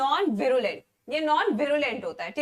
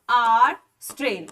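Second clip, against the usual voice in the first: आर strain said slowly and louder, आर drawn out; slow dictation; 4 semitones lower, 6 dB louder than usual. Shown as a demonstration. Teacher dictating to her students, slow and loud. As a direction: spell out आर strain for the class to copy. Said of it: "लिख लो ऐसे".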